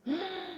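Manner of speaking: a quick high gasp; sharp gasp. Real, not acted. She gasps after recalling her vow, sharp. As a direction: catch breath after a vow.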